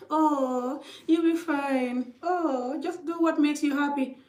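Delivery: high, airy mock reassurance; dismissive advisers. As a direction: lightly mimic a careless counselor brushing it off. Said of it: "So they're like".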